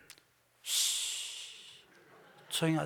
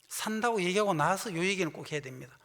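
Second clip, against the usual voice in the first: ordinary talk, nothing marked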